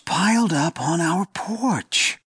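high, slow squawk of alarm; squawking wife. A narrator's reading. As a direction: squawk it out, startled. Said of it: "Elena squawked".

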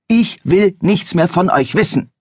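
shouting father. Acted. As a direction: bark the refusal at the stranger. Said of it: "als hätte er sie persönlich angebrüllt".

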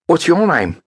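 high, casual flirtatious ask; offhand question. Acted. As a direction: ask just for effect. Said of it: "she asked, just for effect".

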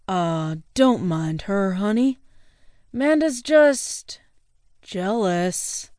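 put-on smooth-talking tipsy boyfriend; drunk guy voice. Drunk Alex soothes date.

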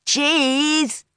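high, drawn-out photo call; crying out. A narrator's reading. Girl calls out for the photo, high cry. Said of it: "When she cried".

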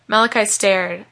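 plain reading voice

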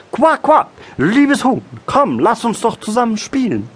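very high-pitched quacking frog voice; eager little frog. A narrator's reading. Frog invites the hen. (hohe, lockende Froschstimme)

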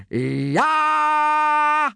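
long, high-pitched battle yell; yelled war cry. A narrator's reading. Gobber demonstrating the war cry to boys, high yell. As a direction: let out a sustained war cry. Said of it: "yelled Gobber the Belch".

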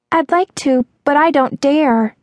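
put-on high, timid girl's voice; high-pitched worried reluctance; fearful. A narrator's reading. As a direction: refuse anxiously, pitched high. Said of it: "her eyes widening with fear".

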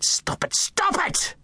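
put-on a boy shrieking to silence a voice in his head; desperate cry. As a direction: cry out to stop the voice. Boy banging head, crying out.

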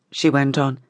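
ordinary delivery, nothing marked

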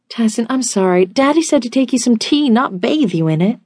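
put-on high, pleading girlish voice; embarrassed, high-voiced protest; flustered apology. A narrator's reading. Embarrassed apology to the man she soaked.

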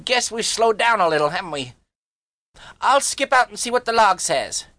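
put-on high, uneasy boy; queasy kid bluffing; brash boy voice. A narrator's reading. Harvey voiced.

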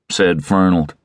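plain reading voice

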